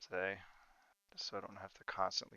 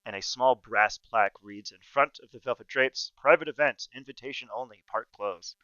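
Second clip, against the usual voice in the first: game master narration; 13 dB louder than usual. Acted. GM scene setting.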